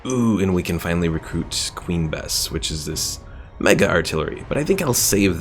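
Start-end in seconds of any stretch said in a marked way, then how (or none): none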